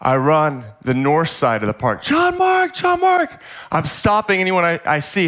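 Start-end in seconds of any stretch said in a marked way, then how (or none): 2.0-3.4 desperate high-pitched shout, reenacted; yelling at top of voice. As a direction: call out for a lost child at full voice. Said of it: "yelling at the top of my voice".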